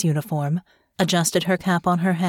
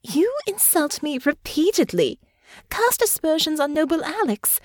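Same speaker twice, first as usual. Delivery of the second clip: indignant; 9 semitones higher than usual. Acted. Indignant outburst at a rival.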